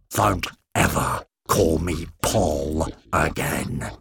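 slow, cold threat; menacing Kevin. Acted. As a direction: lean into each word, in control.

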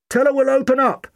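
high, loud call from inside a wall; muffled call. A narrator's reading. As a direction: shout with your head inside a wall cavity. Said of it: "the doctor's muffled voice called from inside the wall".

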